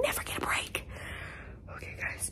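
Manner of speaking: whisper. Real, not acted. Whispering before the attic.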